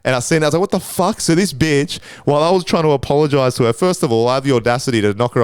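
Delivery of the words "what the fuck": shocked outburst. Retold or imitated. Seeing booger in goggles.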